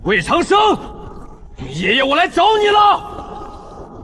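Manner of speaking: high, drawn-out challenge shout; brash battle challenge; defiant call-out. Acted. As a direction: holler for your enemy to face you. Calling out his enemy.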